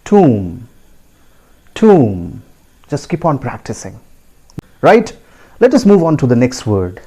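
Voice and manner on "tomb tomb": "tomb" as "toom", silent b; drawn-out "tomb", ending on m; correct pronunciation. Shown as a demonstration. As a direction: model "tomb" with long oo, falling. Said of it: "it is pronounced as".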